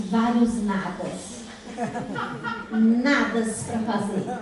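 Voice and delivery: slow, drawn-out playful reply; drawn-out teasing answer to her son, a laugh at the end. Retold or imitated. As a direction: stretch each word out teasingly, ending in a laugh.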